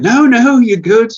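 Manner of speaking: spineless backing down. Acted. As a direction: imitate a spineless man hastily giving in. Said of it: "he hasn't got a spine".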